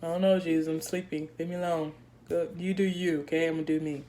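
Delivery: soft, low, dismissive mumble; a mild, quiet voicing of drowsy friends; sleepy disciples. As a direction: brush him off half asleep. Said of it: "I guess they said".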